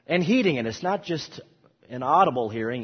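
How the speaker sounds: ordinary talk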